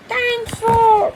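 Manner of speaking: high, loud slow delighted shout; happy toddler cry. Acted. George gets his toy back, high loud joyful shout.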